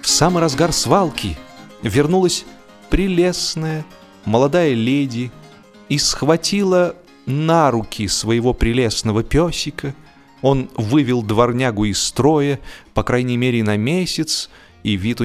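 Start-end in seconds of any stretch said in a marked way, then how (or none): none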